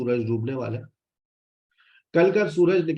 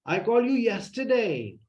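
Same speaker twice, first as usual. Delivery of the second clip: I called you yesterday said slow and higher, you stressed and falling; 7 semitones higher, much slower than usual. Shown as a demonstration. Teacher showing an upset stress on you, high and slow. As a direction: press on you with protest. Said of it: "मैंने तुम्हें कल phone किया था".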